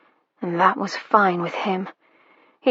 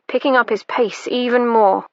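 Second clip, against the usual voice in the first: ordinary delivery, nothing marked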